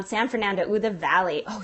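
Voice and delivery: usual speaking voice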